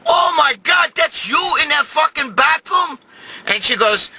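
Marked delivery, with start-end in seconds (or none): 0.1-3.0 high, shouted astonishment; appalled disbelief. Retold or imitated. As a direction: act out being horrified by a discovery. Shocked at finding mother-in-law there.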